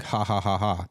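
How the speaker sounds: spoken-out fake "ha ha"; mock laugh. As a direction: laugh it off with separate, forced ha's. Retelling his disbelieving fake laugh.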